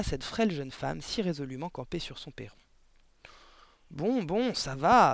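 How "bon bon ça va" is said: loud, higher, irritated bark; gruffly giving in, loudly. A narrator's reading. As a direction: bark it to cut the argument short. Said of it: "aboya-t-il".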